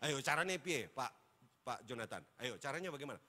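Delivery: ordinary talk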